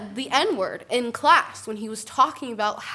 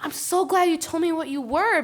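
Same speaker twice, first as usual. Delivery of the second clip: condescending woman; 7 semitones higher, 3 dB louder than usual. Retold or imitated. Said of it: "And she goes".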